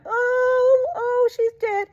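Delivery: high, hammed-up wail of a hired mourner; mock-cry it, voice thrown way up. Hired mourners over the dead girl, mock wailing. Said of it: "they were just crying".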